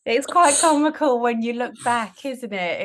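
loud, high, fighting back laughter; trying not to laugh. Real, not acted. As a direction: hold in a laugh while talking. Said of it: "I'm trying not to laugh".

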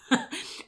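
light airy chuckle; quick unvoiced laugh; soft breathy laugh. Real, not acted. Laugh after quote.

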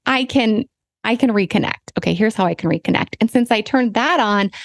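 usual speaking voice

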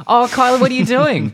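put-on very high, loud broad Australian teen girl; Kylie Mole's friend voice. Broad Australian schoolgirl teasing her friend Kylie. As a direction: squeal it like a cheeky Aussie schoolgirl. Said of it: "I can't do the accent right now".

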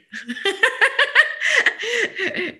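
high burst of laughter. Real, not acted. Laughing at own joke.

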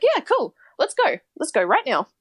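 lightly acted keen helper, starting high and settling; eager agreement. Stranger offering a traveller a lift, lightly eager. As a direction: retell a stranger's upbeat readiness to go.

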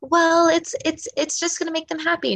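a hint of a nervous dreamer; flustered client. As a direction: play the unsure client caught off guard. Voicing a hesitant client's answer.

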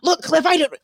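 high, loud mock argument; acting out a fight before the phone rings; mock heated argument. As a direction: snap at Cliff mid-argument.

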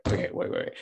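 breathy hushed stop; hushed whisper. Retold or imitated. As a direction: whisper it, caught off guard. Sudden stop at smile.

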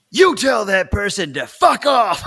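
loud, high yell, half sung. Retold or imitated. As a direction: belt it out like a rallying cry. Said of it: "he yells at me down the phone".

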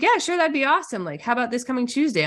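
high-pitched, upbeat quote of a quick yes; breezy agreement. Retold or imitated. Guest voices sister saying yes to the workshop, breezy agreement.

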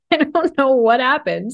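high, laughing reply. Real, not acted. Host laughs about becoming a bad bitch. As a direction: giggle through the words.

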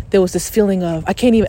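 ordinary talk, nothing marked

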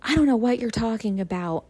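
mildly frantic mom, retold; panicking mom. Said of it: "she was panicking".

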